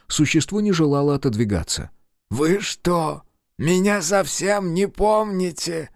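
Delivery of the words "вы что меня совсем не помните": high, slow, eager monster voice; drawn-out and hopeful, high-pitched; eager creature voice. Acted. The creature asks, surprised.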